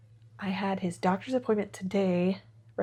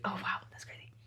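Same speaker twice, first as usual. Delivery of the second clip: whisper; 10 dB quieter, faster than usual. Real, not acted. Surprised smelling, whispered.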